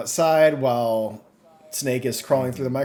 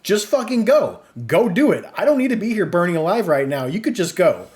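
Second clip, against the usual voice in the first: loud, higher, exasperated shout; frustrated yell; 6 semitones higher, 6 dB louder than usual. Retold or imitated. Player yelling at the sidekick while burning, loud and fed up. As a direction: snap back at him, fed up.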